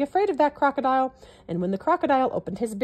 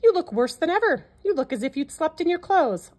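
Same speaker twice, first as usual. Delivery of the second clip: higher, louder mocking bird voice; teasing parrot; 7 semitones higher, 4 dB louder than usual. A narrator's reading. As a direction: poke fun at the sad elephant. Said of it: "the parrot said".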